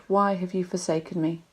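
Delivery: usual speaking voice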